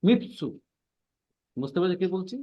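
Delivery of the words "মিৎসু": মিৎসু with a held stop before সু; geminated tsu. Shown as a demonstration. Teacher to students: মিৎসু with a pushed double t. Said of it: "তোমরা একটু ধাক্কা দিয়ে পড়বা".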